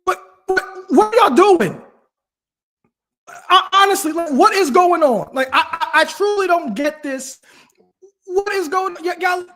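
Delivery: high, loud, incredulous shouting; exasperated rant. Real, not acted. Fan venting at the Eagles about their play, high incredulous shouting. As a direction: demand answers from the team, fed up and baffled.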